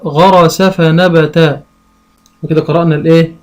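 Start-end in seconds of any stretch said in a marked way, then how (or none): 0.0-1.6 غرس فنبت read slowly in one breath; slow blended reading. Shown as a demonstration. Teacher reading a phrase to a child, slowly. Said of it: "يقول إيه بقى".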